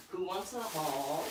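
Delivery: slow, lilting question to the viewers; teasing call. Shown as a demonstration. Vlogger teasing her viewers with a haul, slow lilting question. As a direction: draw out the offer, playful. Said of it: "I'm gonna do a haul".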